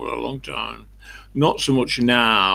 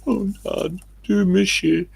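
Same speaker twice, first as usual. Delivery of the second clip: mock crying child; 10 semitones higher, 4 dB louder than usual. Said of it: "stand over my grave crying".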